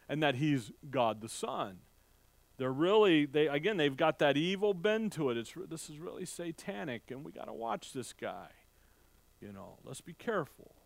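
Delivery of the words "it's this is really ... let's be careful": much softer, conspiratorial murmur; hushed suspicion. Retold or imitated. Preacher voicing wary townsfolk warning each other, hushed.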